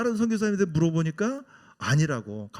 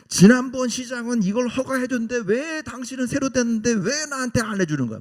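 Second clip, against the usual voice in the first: higher, louder indignant complaint, from a different voice; demanding protest; 8 semitones higher, 3 dB louder than usual. Retold or imitated. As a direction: argue your case hotly against the mayor. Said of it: "막 따지는 거예요". (목소리를 높여 따지듯이)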